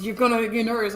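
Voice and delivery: imitated scolding with the teeth shut; through the teeth. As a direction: warn a castmate through clenched teeth. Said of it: "a whole conversation through the teeth".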